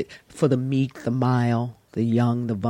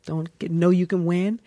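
ordinary talk

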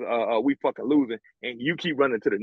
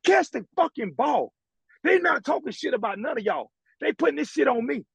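retold angry yell. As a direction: bark it like a frustrated star player. Yelling at fumbling teammate.

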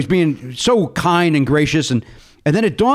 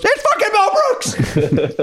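very high, very slow stunned exclamation; awed disbelief; much slower than usual. Retold or imitated. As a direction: gasp it out starstruck. Crew recognises Mel in awe.

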